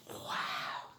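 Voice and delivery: hushed amazed wow; breathy wow. Acted. Teacher shows wonder at the winter scene for kids. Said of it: "amazed at the picture".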